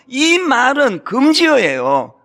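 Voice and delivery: drawn out, every word weighed; slow emphatic warning. Real, not acted. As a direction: spell it out slowly, as a rule. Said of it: "emphatic warning". (천천히 힘주어 경고하듯)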